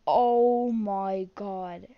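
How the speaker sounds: long, loud drawn-out groan of dismay; slow, loud, embarrassed exclamation; mortified. Real, not acted. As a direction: stretch every word out, loud and appalled. Mortified at own accent.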